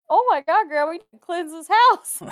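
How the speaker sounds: high, loud mock panic; retold alarm. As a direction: imitate a freaked-out plea to grandma. Retold alarmed reaction to grandma's ghost.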